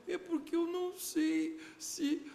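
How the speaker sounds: mother imitated, near tears; voice breaking into tears. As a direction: let the worry break into crying.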